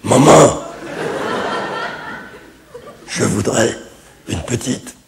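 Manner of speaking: halting, labored voice of a sick father, rasped out word by word; slow throat-cancer impression with long gaps. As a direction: force each word out through a ruined throat. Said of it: "je peux vous imiter mon père qui a un cancer de la gorge".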